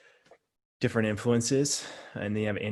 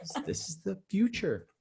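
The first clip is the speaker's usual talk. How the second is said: high, softer note of wonder; amazed realization; 11 semitones higher, 5 dB quieter than usual. Retold or imitated. Coach realizing they live the dream, high-pitched wonder. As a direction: marvel at it, pitched up.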